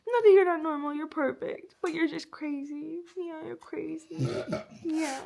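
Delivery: cooing at the dog; tender talk to dog. Real, not acted. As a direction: coo at him lovingly. Talking to her dog.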